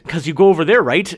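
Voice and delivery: a loud mock Canadian ending on a rising right; mimicking right tag. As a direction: copy the Vancouver guy's tag. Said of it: "every sentence was".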